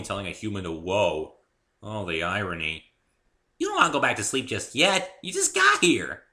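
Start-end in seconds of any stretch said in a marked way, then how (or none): 3.6-6.2 high, loud, fast playful teasing; perky pony voice. A narrator's reading. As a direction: tease him awake like a bubbly pony. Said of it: "very perky".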